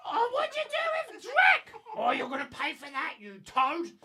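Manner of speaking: high, loud furious screech; angry goblin. Acted. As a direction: scream revenge at the toad. Goblin rages at player.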